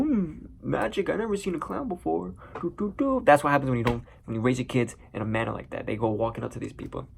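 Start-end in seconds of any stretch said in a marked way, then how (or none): 0.0-3.2 put-on high sing-song child voice; naive little boy. Young Bruce marvelling at a clown's magic, naive little boy. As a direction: play a wide-eyed, clueless kid.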